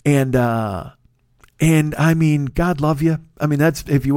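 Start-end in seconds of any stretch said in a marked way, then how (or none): none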